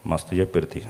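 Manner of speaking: a low, scoffing aside under the breath; mocking mutter. A listener scoffing under her breath at the sermon. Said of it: "బయట చెప్పం మళ్ళీ అది".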